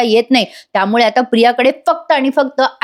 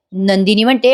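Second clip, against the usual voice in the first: ordinary talk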